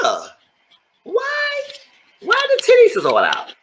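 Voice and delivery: high squeal of outrage; shocked disbelief. Retold or imitated. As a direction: shriek it up in scandalised disbelief. Host shocked at the camera.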